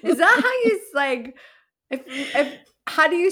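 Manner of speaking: high laughing question; giggling incredulity; laughing disbelief. Real, not acted. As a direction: crack up mid-question.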